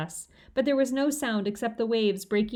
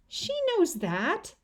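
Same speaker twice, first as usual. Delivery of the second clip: Fudge's voice; 9 semitones higher, much slower than usual. A narrator's reading.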